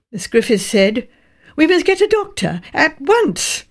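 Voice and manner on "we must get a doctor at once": urgent. A narrator's reading. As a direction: press them to act now. Typist urges a doctor.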